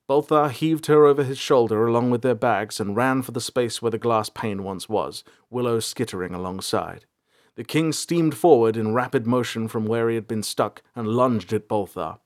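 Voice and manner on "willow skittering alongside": usual speaking voice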